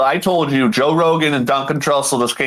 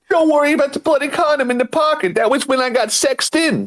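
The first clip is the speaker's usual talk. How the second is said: high, quick put-on tough voice; gang member; 10 semitones higher than usual. Gang member explaining the bloody pocket, high put-on voice. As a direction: echo the gang act, pitched way up.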